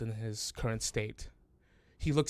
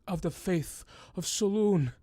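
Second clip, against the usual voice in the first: higher, voiced "faith of Saloon" with a falling end; voiced old man; 7 semitones higher, 4 dB louder than usual. The old man names the cleric's faith aloud, higher and pleading. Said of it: "He says".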